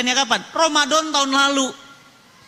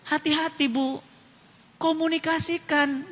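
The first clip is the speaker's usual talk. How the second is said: ordinary delivery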